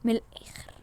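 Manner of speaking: whispered aside. Acted. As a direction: slip it in under the breath. Aside to father. (همس جانبي)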